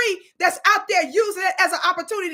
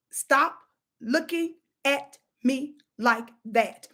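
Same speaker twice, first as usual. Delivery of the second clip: slow, word-by-word warning; mock scolding; 4 semitones lower, 4 dB quieter, slower than usual. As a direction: space out every word, dropping low. Scolding the image on screen.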